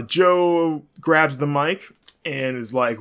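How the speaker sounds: ordinary delivery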